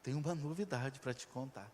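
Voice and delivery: soft, sweet-talking lead-in; softly butter up her father before the news; coaxing daughter. Acted. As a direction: daughter sweetly prepping dad for big news. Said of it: "Ela chega e fala assim".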